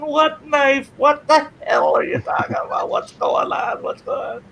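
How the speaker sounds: high, frantic protest, fading at the end; bewildered accused brother. Retold or imitated. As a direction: protest like a kid wrongly accused. Retold bewildered brother accused by cops.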